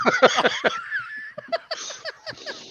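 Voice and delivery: high burst of real laughter; big laugh. Real, not acted. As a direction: crack up at your own story. Laughing at his own story.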